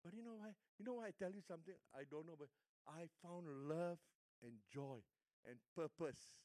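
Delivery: usual speaking voice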